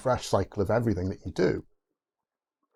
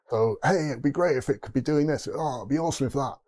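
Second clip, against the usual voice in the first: higher-pitched eager suggestion; mock enthusiasm; 5 semitones higher than usual. Colleague pitching nice-to-have improvements, mock enthusiastic. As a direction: act out someone keen on a new idea.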